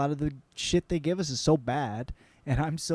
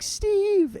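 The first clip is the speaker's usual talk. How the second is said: high excited call of his name; starstruck call; 3 dB louder than usual. Retold or imitated. Girlfriend calling to the actor, high and excited. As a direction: call out to him like a thrilled fan. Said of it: "she's like".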